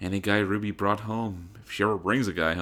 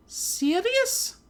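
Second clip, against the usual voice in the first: haughty heiress greeting. A narrator's reading. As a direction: greet her rival from on high. Weiss echoing.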